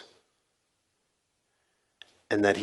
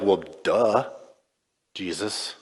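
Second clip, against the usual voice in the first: sarcastic duh; 4 semitones lower, 5 dB quieter than usual. Retold or imitated. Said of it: "they're like".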